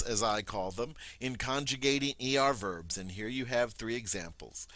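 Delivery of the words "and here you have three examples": ordinary delivery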